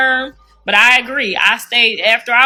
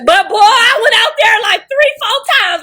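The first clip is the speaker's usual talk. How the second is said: cracking up while telling it; laughing shout; 8 semitones higher than usual. Real, not acted. Excited storytelling with laughter.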